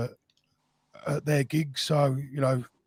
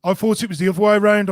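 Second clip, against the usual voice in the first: said again louder and quicker so it carries; repeated louder; 4 semitones higher, 6 dB louder, faster than usual. Real, not acted. Guest asks sorry, host repeats louder and faster. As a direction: push it out louder so she catches it.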